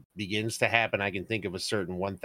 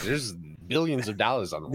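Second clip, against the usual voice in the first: ordinary delivery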